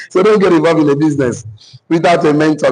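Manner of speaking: normal talking voice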